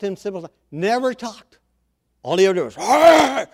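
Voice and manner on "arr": loud, high monster roar; imitated beastly bellow; Hulk roar. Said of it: "all you ever heard from the Hulk".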